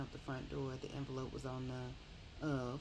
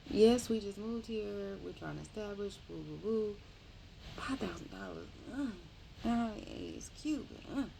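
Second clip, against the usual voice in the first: flustered; 5 semitones higher, 4 dB louder than usual. Retold or imitated. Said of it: "So she was like".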